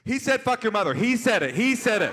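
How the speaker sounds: high, loud tattling shout; panicked blurting. Retold or imitated. Interpreter breaking composure to tell cop, frantic shout. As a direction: yell it out, disowning the words.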